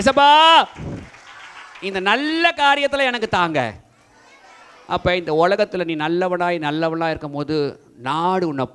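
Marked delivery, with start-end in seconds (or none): 0.0-3.8 higher, much louder, ஏசப்பா cried out; loud prayer call. Shown as a demonstration. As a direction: lead the room in a loud prayer. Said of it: "கை தூக்கு". (உரத்த ஜெப அழைப்பு)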